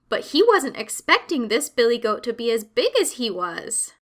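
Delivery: usual speaking voice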